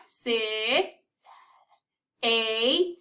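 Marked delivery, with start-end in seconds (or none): 1.2-2.2 breathy unvoiced number; whispered count. Shown as a demonstration. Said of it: "Let's try whispering the counts this time".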